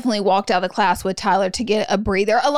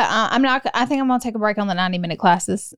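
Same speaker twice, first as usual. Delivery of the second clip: ordinary delivery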